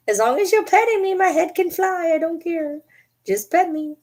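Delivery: put-on very high, babyish pet voice; talking-dog voice. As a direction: plead as a dog who wants petting. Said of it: "She's like".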